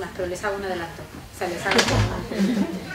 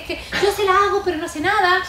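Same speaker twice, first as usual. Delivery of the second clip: higher, louder fretting parent; worried parent; 7 semitones higher, 6 dB louder than usual. Retold or imitated. Teacher voices a parent who feels helpless, higher and louder. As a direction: complain helplessly like a worried mother.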